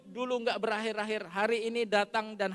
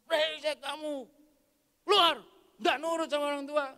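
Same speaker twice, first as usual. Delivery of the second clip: put-on high, loud tirade at the children; angry scolding; 8 semitones higher, 7 dB louder than usual. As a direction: play a father throwing his kids out. Acts father scolding his children. (membentak anak dengan marah)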